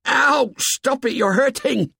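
very high pained cries; high, hurt yelping; squeal of real pain. A narrator's reading. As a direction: squeal and beg them to stop. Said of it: "then in real pain".

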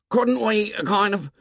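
put-on high, timid boy's voice; a nervous, bespectacled boy pleading; Piggy voice. A narrator's reading. Piggy's voice in the story.

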